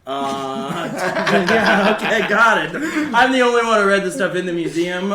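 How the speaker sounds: loud and high, laughing while talking. Real, not acted. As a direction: rattle it off through giggles. Laughing, loud excited reply.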